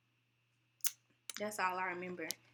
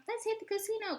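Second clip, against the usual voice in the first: much higher, eager invitation; eager friends; 13 semitones higher than usual. Retold or imitated. Imitating friends urging a casino trip, excited. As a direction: pitch it like friends hyped for a night out. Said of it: "you know, like".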